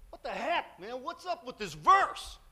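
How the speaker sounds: high, loud, drawn-out demand; incredulous yelling. Retold or imitated. Classmate demanding answers about yearbook verse, incredulous yelling. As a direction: holler it in hammed-up disbelief.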